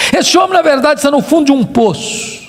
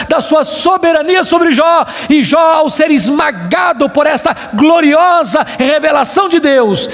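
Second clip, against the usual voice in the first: raised, shouted proclamation; fervent preaching; 3 semitones higher than usual. Real, not acted. Preacher proclaiming God's glorious revelation to Job, fervent.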